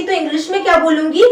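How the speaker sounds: ordinary delivery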